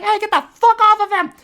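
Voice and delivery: high, loud mock scream at the attacker; retold scream. Lady yelling from her car at the attacker. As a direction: yell it from a car window. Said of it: "started screaming".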